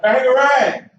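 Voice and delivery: loud, high shouted cue word; host showing the crowd what to shout, shouted; demo shout. Shown as a demonstration. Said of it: "I need everybody in the room to shout".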